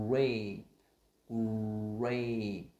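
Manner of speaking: "grape" loud, the gr run slowly into the vowel; R blend demo. Shown as a demonstration. Teacher linking g and r, exaggerated blend. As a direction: round into the r and burst out "grape". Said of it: "Nó sẽ nối giữa".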